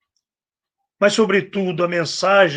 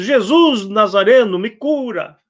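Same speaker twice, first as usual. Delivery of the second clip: higher, pleading retold cry; imitated shouting; 5 semitones higher, 4 dB louder than usual. Blind man keeps begging the Nazarene for healing, imitated shout. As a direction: call out as if over a crowd, half-voiced. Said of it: "E ele continuava gritando".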